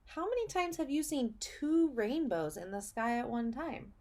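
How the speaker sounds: normal talking voice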